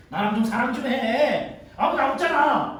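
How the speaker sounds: loud, high, drawn-out whining; pleading temptress. Acted. Master's wife pressing Joseph to love her, loud whiny pleading. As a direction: coax insistently, stretching the words. 마님이 요셉을 조르며 유혹.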